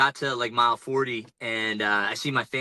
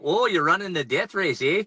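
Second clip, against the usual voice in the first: light imitation of a Canadian accent, higher pitch; hint of a Canadian accent, pitched up; 6 semitones higher, 3 dB louder than usual. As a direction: do a friendly Canadian shopkeeper, eh. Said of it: "they've got the Canadian accent".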